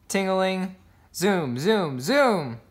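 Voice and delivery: plain reading voice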